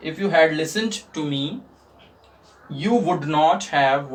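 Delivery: very slow, each word spelled out; teacher dictating slowly to students; slow dictation. Shown as a demonstration. Students ko dhire-dhire likhwate hue. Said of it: "teacher dictates English slowly".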